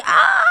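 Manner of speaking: very high, loud shriek; imitated scream. Sister hit by a bush, screaming. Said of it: "she was screaming".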